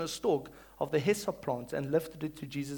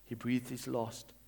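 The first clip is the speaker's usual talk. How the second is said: normal talking voice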